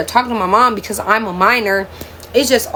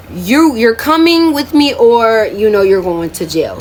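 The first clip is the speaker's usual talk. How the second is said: mock-angry mother, raised voice; stern ultimatum; 3 semitones higher, 4 dB louder, slower than usual. Mother's ultimatum with police, retold.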